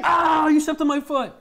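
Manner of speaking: high, loud cry of pain; overdramatic yelp. Real, not acted. Streamer complaining that partner stepped on his foot, overdramatic yelp. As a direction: yelp as if badly hurt. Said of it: "That was really overdramatic".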